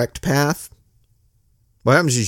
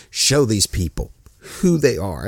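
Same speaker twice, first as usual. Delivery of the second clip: a lower, proud inner voice; prideful voice; 5 semitones lower than usual. Retold or imitated. The pastor voices the proud inner voice urging revenge, prideful. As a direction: let pride speak, low and sure. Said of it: "it's a prideful voice".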